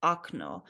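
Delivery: "okno" said as ak-NO; "okno" opening on "ah", falling on the stressed NO; first O of "okno" turned to "ah"; unstressed O as ah. Shown as a demonstration. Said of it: "when it is not emphasized".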